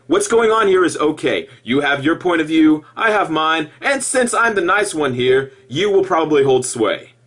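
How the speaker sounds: normal talking voice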